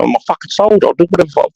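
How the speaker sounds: high-pitched mock protest; retold indignant retort. Acted retort in same showdown.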